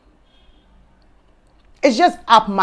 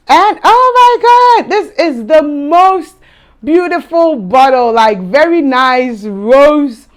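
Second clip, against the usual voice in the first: much higher, louder and slow, stretched in delight; gushing awe; 7 semitones higher, 6 dB louder, slower than usual. Real, not acted. Unboxing for viewers, gasping at the gorgeous bottle. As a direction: swoon over the bottle, drawing it out.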